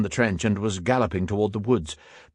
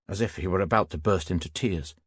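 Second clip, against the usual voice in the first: usual speaking voice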